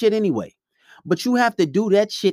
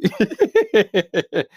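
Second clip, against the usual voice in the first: amused laugh; 3 semitones higher, 4 dB louder than usual. Real, not acted. Owning up to being with another girl, amused laugh. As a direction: laugh at your own admission. Own chuckle at his admission.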